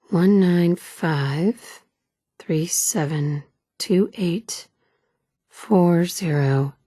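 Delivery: each digit spaced out slowly; deliberate slow number readout; slow code read. Acted. Reading out a door code slowly.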